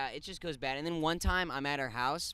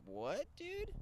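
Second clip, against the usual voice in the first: surprised; 12 semitones higher, 5 dB quieter than usual. Retold or imitated. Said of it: "And then I'm like".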